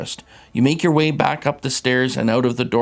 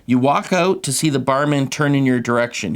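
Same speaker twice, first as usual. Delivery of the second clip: normal talking voice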